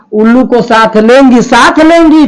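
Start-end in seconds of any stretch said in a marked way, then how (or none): none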